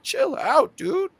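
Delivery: put-on very high little boy voice; kid talking back. Kid telling Gary Payton to chill, very high. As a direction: sass him back like a kid. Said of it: "He's like".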